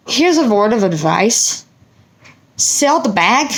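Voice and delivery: slow, deliberate advice; advising woman. Retold or imitated. The Benadryl woman advising her to sell the bag, slow and deliberate. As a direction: lay out the advice slowly like a wise stranger.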